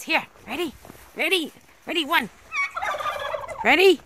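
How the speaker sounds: excited calling. Real, not acted. Calling dog to fetch.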